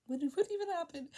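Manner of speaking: high-pitched incredulous question; dramatised confusion; mock bewilderment. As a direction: squeak it out, stammering at the start. Retold bewildered reaction.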